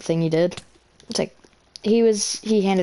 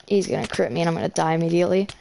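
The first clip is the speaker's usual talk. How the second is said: usual speaking voice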